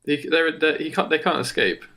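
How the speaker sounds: normal talking voice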